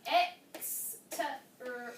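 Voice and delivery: EX-TER-AT-TER- as slow, disconnected single sounds; halting phonics sounding-out of EX-TER-AT-TER-; sounded out letter-by-letter. Shown as a demonstration. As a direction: struggle through EX-TER-AT-TER- sound by sound. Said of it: "instead of seeing sounds in their own".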